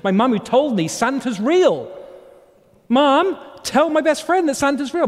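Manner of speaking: put-on high, loud childish appeal to mum; a desperate six-year-old calling to the front seat; pleading child. Boy begging mum to back him up, high and loud.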